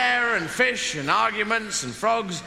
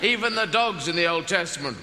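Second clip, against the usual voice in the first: normal talking voice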